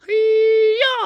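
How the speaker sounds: piercing high hee-yah shout; karate yell. Acted. Thrilled collector yelling at his cards, a high karate cry. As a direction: let out a martial arts battle cry. Excited over a card set.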